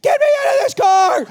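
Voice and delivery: high, loud shriek of a trapped mom; imitating mom screaming; panicked scream. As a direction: scream for help in a hammed-up panic. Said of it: "she's at this point screaming".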